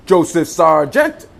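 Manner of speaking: loud, slow hail across the yard; drawn-out greeting called to a friend; calling out. Acted. Calling out to arriving driver.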